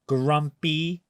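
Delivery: plain reading voice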